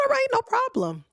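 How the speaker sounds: squeaky high all right, no problem; high sing-song agreement. Retold or imitated. Telling her man it's fine, in a high sing-song voice. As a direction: chirp it up high, sweetly. Retold agreeing to him driving.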